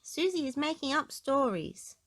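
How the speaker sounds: put-on little girl complaining to mum; high tattling child. A narrator's reading. Peppa tells on Susie to Mummy, high-pitched. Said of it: "cried Peppa".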